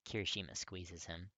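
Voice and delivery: ordinary delivery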